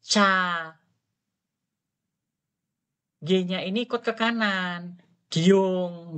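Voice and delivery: "Cha" and "Gyong" said apart; syllable demo. Shown as a demonstration. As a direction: break "Gyong" into its sounds for the class.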